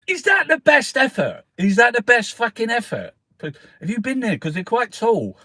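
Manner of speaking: high, loud, scornful mock critic. Mimicking people criticising Stonehenge, high scornful mock. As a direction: sneer it like a disappointed tourist. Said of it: "people were criticising and going".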